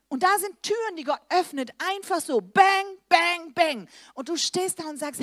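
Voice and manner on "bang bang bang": loud, high bang bang bang; doors bursting open. Acted. Preacher mimicking doors God opens, loud punchy bangs. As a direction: punch each bang like a door slamming open.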